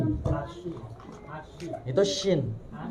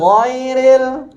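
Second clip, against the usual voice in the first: Ghairil called out higher and louder; loud model of Ghairil; 6 semitones higher, 6 dB louder than usual. Shown as a demonstration. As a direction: give Ghairil firmly for the student to copy. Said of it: "Ghairil dulu lah". ("Ghairil" dicontohkan keras)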